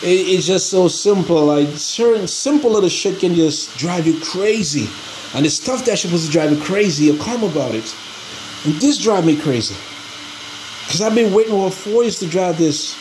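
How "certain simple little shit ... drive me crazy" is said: ordinary talk, nothing marked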